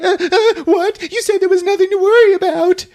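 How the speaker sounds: high, fast, panicky protest; panicked. Acted. Amy panics as Kelly goes inside, high and fast.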